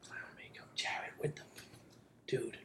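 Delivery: hushed aside whisper. Acted. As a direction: whisper it so the clerk can't hear. Aside to brother about clown.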